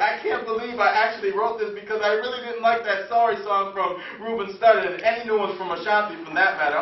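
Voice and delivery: driving, rhythmic slam delivery; performed poetry with a repeating, list-like cadence; spoken-word poem. Acted. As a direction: roll through the poem with steady, projected insistence.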